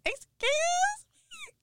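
slow, high, squeaky disbelief; mock shocked at the boyfriend; shocked excuse me. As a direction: stretch it out in stunned disbelief. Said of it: "So I look at him like".

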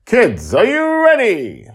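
high, loud, slow call to the crowd; rallying showman. Acted. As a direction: stretch it out like a ringmaster. Justin rallying the family.

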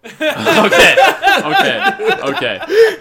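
high laughing okays. Real, not acted. Host laughing after being threatened over Theon. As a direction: giggle out each okay.

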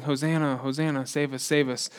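sorrowful hushed hosanna; hushed retelling. Retold or imitated. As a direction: say it quietly, heavy with what's coming. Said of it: "these people that are saying".

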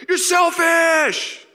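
high, long drawn-out scream; hammed-up hostile crowd shriek; screaming heckler. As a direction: scream the insult from the stands.

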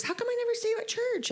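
imitating coworker. Coworker asking her at work, high mock-cheery voice. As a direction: play the chirpy coworker asking why you never come.

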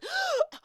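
sharp, very high gasp; startled gasp. Retold or imitated. Waking from not breathing, startled gasp. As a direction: gasp like you just woke. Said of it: "Oh, I wasn't breathing".

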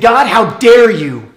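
loud, slow, high cry of betrayal; furious accusation. Retold or imitated. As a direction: hurl it at God, drawn out. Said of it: "She said".

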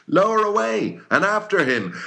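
high, loud sailors' shout; crew shouting. A narrator's reading. Sailors shout back to their captain, high and loud. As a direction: roar it back as one crew. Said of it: "at every shout".

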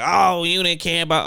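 put-on argumentative yell; mock yelling. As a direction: imitate someone yelling an accusation. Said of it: "just don't be yelling like".

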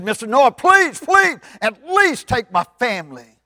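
loud, high plea for your family; acted frantic pleading; desperate begging. Daddy begs for his family.

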